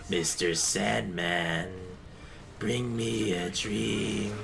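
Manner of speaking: low, slow villain croon; Imperfect Cell voice. Retold or imitated. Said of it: "I was singing [name] as Imperfect Cell".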